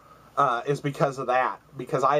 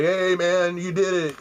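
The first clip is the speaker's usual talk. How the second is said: excited cheer; 6 semitones higher, slower than usual. Retold or imitated. Friend cheering, shaking him.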